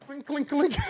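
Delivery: high, giggled clinking; laughed sound imitation. Storyteller mimicking the noise mid-goodbye, high and laughing. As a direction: imitate the clinking while cracking up.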